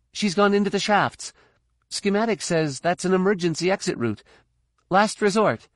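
high-pitched, urgent report; urgent young Jedi. Acted. Jedi reporting her comrade went into the shafts, urgent.

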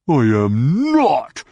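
growled. Acted. Hutt denying to Jaina that he spies, slow growl. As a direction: drag out a creature growl. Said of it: "the Hutt growled".

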